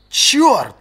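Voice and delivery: a loud, high burst of alarm; sharp cry. A narrator's reading. Late captain cries out in alarm. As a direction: blurt it out in sudden panic. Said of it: "вскричал Джизаль".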